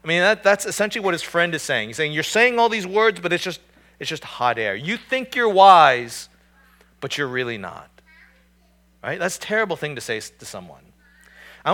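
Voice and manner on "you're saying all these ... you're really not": raised, louder sneering rebuke; mocking scorn. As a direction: scoff at a friend's hollow words. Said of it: "he's mocking again".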